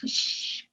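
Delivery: long unvoiced shush. Adult quieting a crying child, shushing. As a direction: hush a crying child with a shh. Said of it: "Si un niño está llorando".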